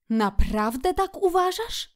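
high, questioning sincerity; earnest question. A narrator's reading. Szczur doubts the food is too much, earnest question. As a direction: ask it in all seriousness. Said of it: "zapytał szczur z powagą".

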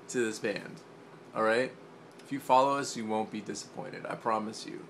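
ordinary talk, nothing marked

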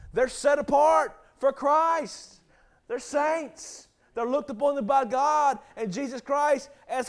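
loud, ringing sermon delivery; preaching at full force. Acted. Pastor preaching loudly to his congregation about saints. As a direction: preach it out to the whole church.

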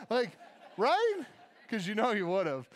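ordinary delivery

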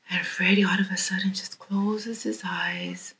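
ordinary talk, nothing marked

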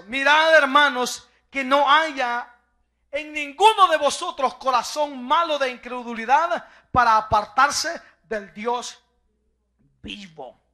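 slow, loud, weighty recital; solemn scripture reading. Acted. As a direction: proclaim God's oath slowly. (lectura solemne y lenta de la Escritura)

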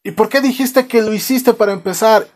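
high, exasperated question; mock angry demand. Father demands why son confessed, mock exasperated. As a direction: play a baffled dad yelling back.

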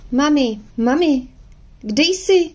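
high calling shout for mum; girl calling out. A narrator's reading. Girl shouting for her mum across the house, high calling. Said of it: "s křikem".

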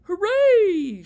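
very high, loud squirrel cheer; excited shout. Acted. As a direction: cheer it out at the top of your voice. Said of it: "shouted Sherwood Squirrel".

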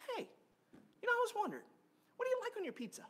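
high, bright-eyed icebreaker question; mock friendly opener. Pastor acting out a stranger's icebreaker question, high and chirpy. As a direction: pitch it way up like a cheery stranger making small talk. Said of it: "you say".

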